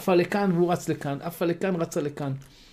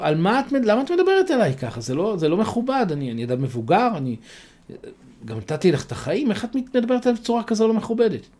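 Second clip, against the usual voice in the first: higher, louder offended complaint; indignant protest; 7 semitones higher, 4 dB louder than usual. A narrator's reading. Old man scolds the mocking dove, higher indignant protest. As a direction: protest at being insulted, voice rising.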